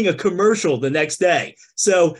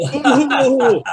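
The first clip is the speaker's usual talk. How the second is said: mock ooh; 7 semitones higher, 5 dB louder than usual. Mock awe at bringing back show.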